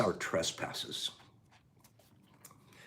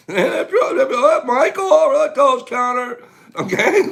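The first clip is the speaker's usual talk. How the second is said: higher and louder, animated; excited; 12 semitones higher, 8 dB louder than usual. Real, not acted. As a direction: wag a playful finger at viewers.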